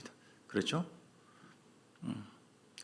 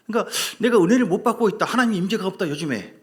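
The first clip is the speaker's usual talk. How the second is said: mock frustrated complaint; 5 semitones higher, 6 dB louder than usual.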